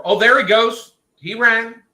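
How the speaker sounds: sudden high, loud outburst; excited exclamation. Real, not acted. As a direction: blurt it out high and loud, caught mid-sentence. Bunny suddenly bolts away.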